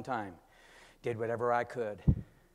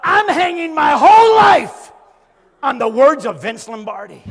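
incredulous shout; 11 semitones higher, 19 dB louder than usual. Retold or imitated. The pastor realizing his motto came from a coach, shouting. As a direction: shout it at yourself in disbelief.

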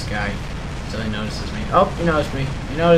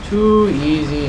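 drawn-out, slower smug taunt; slow gloat; slower than usual. Real, not acted. Gamer to beaten enemy, slow smug gloat. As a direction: stretch it out, gloating. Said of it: "repeats taunt".

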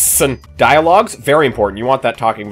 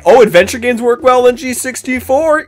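high, loud eager anticipation; excited; 10 semitones higher, 6 dB louder than usual. Real, not acted. Reacting to reviews of his new game, excited. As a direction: bounce as the scores come in.

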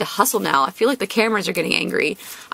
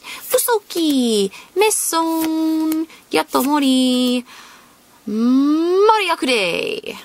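ordinary talk

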